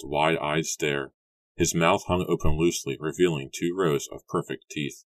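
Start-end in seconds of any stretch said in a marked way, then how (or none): none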